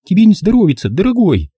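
high, fussing mother's concern; lightly voiced anxious mum; mother fretting over her son's untouched breakfast, worried. A narrator's reading. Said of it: "озабоченно посмотрела".

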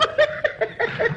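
rippling, high little laugh; high giggle. Acted. Mother finishing her song for her son, high giggle. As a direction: giggle after singing.

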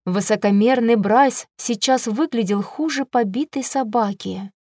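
plain reading voice

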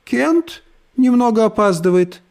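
high chirpy sing-song voice; cartoon princess. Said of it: "прощебетала Брит Мари".

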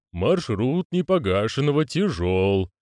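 slow, solemn proclamation; drawn-out resounding ritual voice; hollow proclaiming voice. Acted. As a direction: proclaim it with long, weighty vowels. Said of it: "провозгласил гулкий голос".

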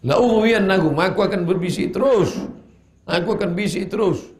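high, forceful defiant declaration; Satan's vow. Acted. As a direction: proclaim it loudly as the relentless tempter.

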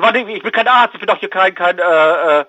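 normal talking voice

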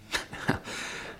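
a quiet scornful breath of a laugh; soft sardonic chuckle; short bitter laugh. Acted. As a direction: laugh off the idea with contempt. Mocking the scoundrel's money.

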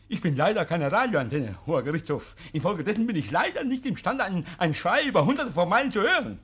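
höher und lauter, schnippisch als alter Zeuge; den alten Buckley nachgeahmt. Acted. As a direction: den Zeugen frech nachäffen. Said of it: "So spricht er. Genauso". (Oak ahmt den alten Buckley vor dem Gericht nach)

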